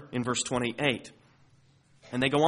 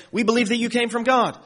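higher, louder declaration; eager disciples; 8 semitones higher, 5 dB louder than usual. Acted. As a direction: proclaim it like confident followers. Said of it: "they say".

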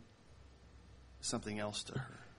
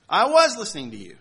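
louder, higher defensive protest; defensive retort; 7 semitones higher, 12 dB louder, faster than usual. Retold or imitated. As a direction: snap back in defense. Husband defends himself in quarrel.

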